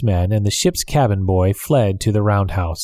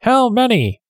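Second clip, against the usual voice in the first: yelled; 13 semitones higher, slower than usual. Acted. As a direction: shout back across the dark. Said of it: "Tom Smith yelled".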